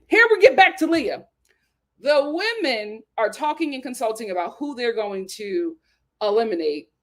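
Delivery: ordinary talk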